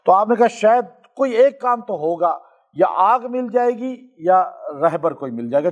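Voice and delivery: ordinary talk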